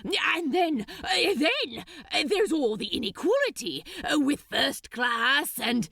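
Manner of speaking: high, rushed stammering; flustered babble. Acted. Crowley still scrambling to argue, high and rushed. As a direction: scramble for arguments.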